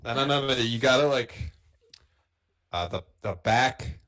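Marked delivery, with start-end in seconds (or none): none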